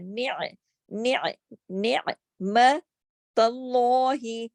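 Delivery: نعمت with a clear, full ع, slowly; full ain. Shown as a demonstration. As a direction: press the ع from the throat. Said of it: "عین کو پورا صحیح ادائیگی کے ساتھ". (نعمت میں عین پوری ادائیگی کے ساتھ)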